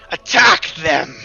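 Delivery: loud, slow, forceful order; Witch Queen commanding. Acted. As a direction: bellow the command, stretching each word. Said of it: "she goes".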